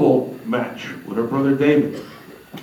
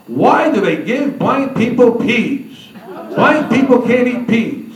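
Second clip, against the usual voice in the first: higher, slow mock complaint; complaining to servant; 6 semitones higher, slower than usual. Mary complaining to Henry over dinner, high mock complaint. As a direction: imitate a demanding woman grumbling to her servant. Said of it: "she said this to Henry as a servant".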